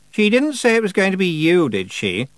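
a pointed, suspicious demand; sharp. Acted. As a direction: accuse her with the question. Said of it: "said Anagramma sharply".

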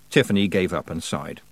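ordinary delivery, nothing marked

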